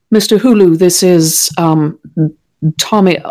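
a hint of a hostess doubting the name; unsure introduction. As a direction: check the name with a climbing question. [name] introduces Thomas, unsure of name.

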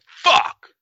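sudden high frustrated cry of fuck; exasperated yell. Retold or imitated. Boyfriend yelling in frustration at dinner plans, exasperated yell. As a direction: blurt it out in exasperation, high and loud. Frustrated outburst at girlfriend's answer.

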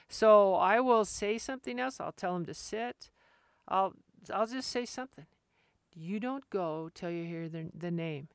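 ordinary talk, nothing marked

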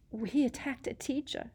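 put-on high, frightened girl; shocked and scared; whimpering. A narrator's reading. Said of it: "Hermione whimpered".

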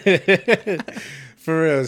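laughing it out high. Real, not acted. Agreeing with his friend, laughing loud. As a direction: burst into laughter on the word.

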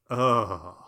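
long, low groan of discomfort; uncomfortable groan. Real, not acted. Incredibly uncomfortable about the scene.

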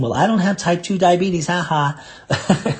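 imagined dismissive listener. Acted. Listener thinking diabetes doesn't apply to them, mock dismissive. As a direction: imitate a listener laughing off the risk. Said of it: "those of you out there thinking".

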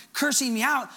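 ordinary delivery

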